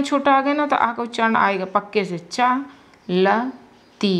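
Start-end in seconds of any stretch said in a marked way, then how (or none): 2.2-4.1 चलती drawn out very slowly, with a long ती; stretched demo. Shown as a demonstration. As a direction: spell चलती out slowly for the class. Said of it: "बड़ी ती के चलती".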